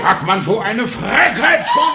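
slow loud outraged shout. Acted. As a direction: explode with disbelief. Husband outraged.

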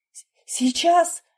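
high, stammered reply; flustered panic. A narrator's reading. Nurse fumbling syringe answers surgeon, flustered and stammering. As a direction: stumble on the first sound, pitch shooting up.

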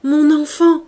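loud, high mother's cry; cried out. A narrator's reading. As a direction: cry out for your child. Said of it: "s'écria-t-elle".

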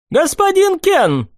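put-on high light young nobleman; young prince voice.

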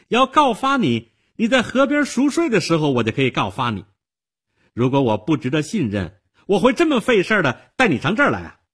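put-on high, loud indignant girl; heated retort. A narrator's reading. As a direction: argue back hotly, pitch way up. Girl retorts in acted quarrel. (激动气愤地反驳)

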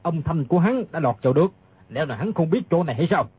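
low, rapid retort; arguing back. Acted. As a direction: argue fast in a low, scornful voice. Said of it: "Bao Bất Đồng cãi lại". (giọng trầm, cãi lại dồn dập)

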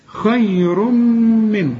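خير من drawn very slowly with a clear unnasal n; slow clear izhar. Shown as a demonstration. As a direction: recite خير من without ghunna. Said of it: "ما تغنش هنا".

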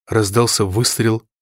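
usual speaking voice

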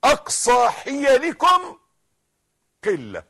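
loud, high challenge to the world; full-force preaching. Real, not acted.

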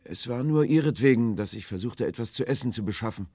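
high voice, loud at first then easing; pleading stranger. A narrator's reading. Stranger justifies himself to the sailors, high and pleading. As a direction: explain it urgently from afar.

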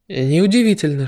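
usual speaking voice

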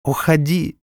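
high tearful cry; sobbing plea. A narrator's reading. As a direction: cry it out through tears. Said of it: "разразилась рыданиями".

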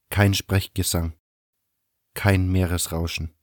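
ordinary talk

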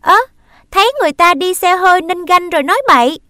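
high, loud and fast retort; snapping back. Acted. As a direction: fire it back quickly. Sister snaps back in quarrel.

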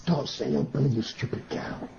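hushed, breathy off-phone scold; whispered aside. Acted. Manager whispering to the maid, a hushed scold. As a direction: hiss it aside so the caller won't hear.

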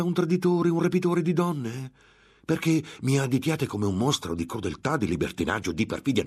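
il monaco infuriato aggredisce la ragazza; furia. A narrator's reading. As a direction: accuse urlate più alte e più forti. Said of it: "la furia lo riprese". (rabbia furiosa del monaco)

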